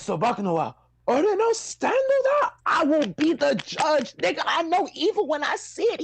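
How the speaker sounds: quoting Jotaro in a put-on voice; Jotaro impression. Podcaster quoting Jotaro, put-on high voice. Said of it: "he says".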